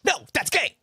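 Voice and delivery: high, loud mock yell; shouted punchline. Quoting a comedian's joke punchline, shouted. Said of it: "the punchline for a lot of jokes".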